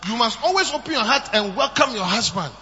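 ordinary delivery

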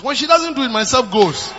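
shouted point. Real, not acted. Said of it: "first take of the point".

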